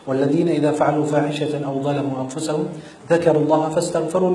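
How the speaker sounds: fast, even murmured Arabic recital; brisk Quranic recital; rapid Quran recitation. Shown as a demonstration. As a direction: rattle through the verses quickly.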